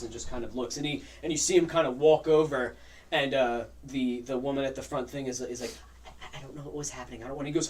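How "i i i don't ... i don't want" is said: flustered waitress. Acted. Frightened waitress explains to captain.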